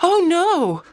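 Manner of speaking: high, loud alarmed "Oh, no"; alarmed cry. Acted. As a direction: blurt it out in alarm. Girl sees man go bald.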